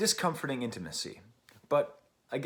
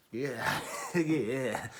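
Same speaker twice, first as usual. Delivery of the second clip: laughing through the yeahs; real amused chuckle on yeah. Real, not acted. Reviewer laughs at the lyric, laughing.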